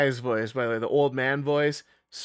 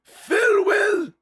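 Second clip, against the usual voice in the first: put-on very high, slow, loud old man; theatrical high farewell; 7 dB louder, slower than usual. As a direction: draw it out grandly. Character says goodbye.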